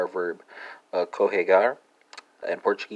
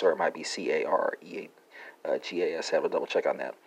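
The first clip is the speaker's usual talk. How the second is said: unsure aside; 4 semitones lower, 7 dB quieter than usual. Real, not acted. Unsure alternative spelling aside.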